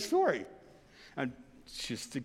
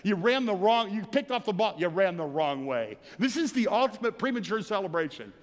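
ordinary talk

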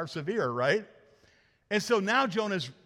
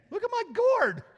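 much higher, louder show-off squeal; hammed-up bragging about a gourd; mock excited Jonah; 5 dB louder than usual. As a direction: squeal it like a kid with a new toy. Voicing Jonah showing off gourd.

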